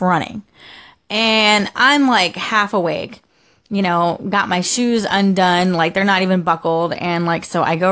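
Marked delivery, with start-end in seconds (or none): none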